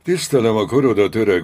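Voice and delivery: loud, commanding judge; stern address. A narrator's reading. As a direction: address the prisoner loudly, with authority.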